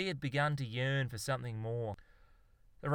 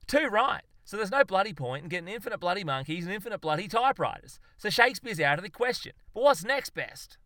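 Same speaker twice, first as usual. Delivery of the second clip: much higher, louder excited rant; worked-up agreement; 7 semitones higher, 6 dB louder than usual. Acted. Zoologist drives his mate toward the answer, worked up. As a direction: seize on it and race to your question.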